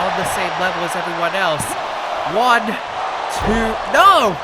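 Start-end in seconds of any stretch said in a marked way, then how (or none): 2.3-4.4 rising near-fall count, shouted no; excited count. Real, not acted. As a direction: count the pin, then shout no. Near-fall count in live match.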